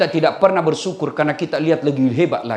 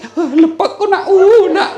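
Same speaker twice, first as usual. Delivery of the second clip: mock cry of pain; 13 semitones higher, 6 dB louder, slower than usual. Mother pleading with biting baby.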